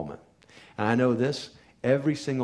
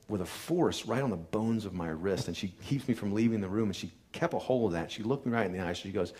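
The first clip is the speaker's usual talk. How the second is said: normal talking voice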